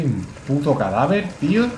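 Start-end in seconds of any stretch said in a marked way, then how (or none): none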